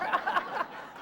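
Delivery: a bright, high burst of laughter; real laugh. Real, not acted. Speaker laughs with the audience about her proudest moment, a real laugh. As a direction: laugh out loud at your own joke.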